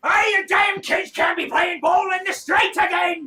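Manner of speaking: furious high yell from a portly man; angry shout. Acted. Portly man yells at kids who broke his window, furious shout.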